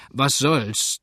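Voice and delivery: plain reading voice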